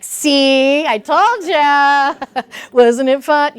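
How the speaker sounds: smug, rubbing it in; gloating. Retold or imitated. Parent gloating after the donuts, hammed-up smug. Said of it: "be like".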